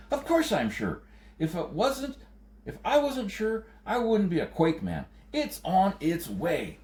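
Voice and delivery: put-on high, urgent, pleading old quake man; anxious quake man. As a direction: push the danger, high and pressing. Quake man insists he's sure.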